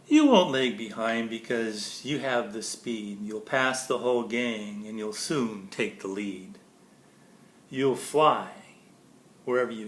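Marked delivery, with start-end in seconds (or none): none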